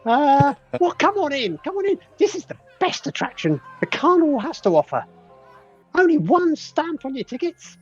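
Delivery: very high, bright little pixie; squeaky fairy-folk greeting. Acted. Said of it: "I'm gonna have to try and do a pixie voice here".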